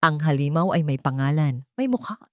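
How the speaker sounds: usual speaking voice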